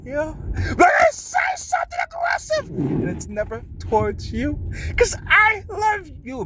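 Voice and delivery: breaking into cries, voice jumping high; crying it out through the words; real crying, voice cracking high; tearful cry. Real, not acted. Emotional outburst about holding anger.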